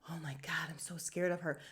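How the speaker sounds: hushed, mock-scared whisper. Mocking cast members in a hushed mock-scared whisper. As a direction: breathe it like a fake frightened gossip.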